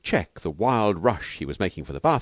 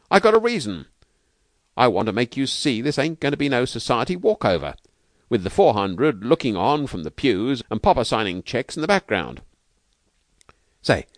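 earnest, slangy trainer, pitched higher; heated insistence; 6 semitones higher, 4 dB louder than usual. A narrator's reading. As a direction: press the point with feeling. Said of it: "said Steve warmly".

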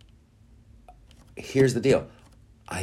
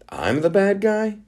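pitched way up, incredulous; exasperated disbelief; 14 semitones higher, 3 dB louder than usual. Retold or imitated. Sinead, misunderstood by everyone, asking in disbelief. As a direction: repeat it higher, unable to believe it. Voicing Sinead's frustration, repeated.

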